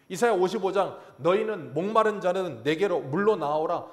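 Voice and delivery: loud, rising sermon climax; fervent preaching. Acted. Pastor urging the congregation, loud fervent preaching. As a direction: preach it out to the whole church.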